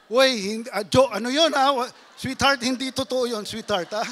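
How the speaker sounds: loud, panicky excuses to his wife; flustered denial. Acted. Husband caught out, loudly denying to his sweetheart.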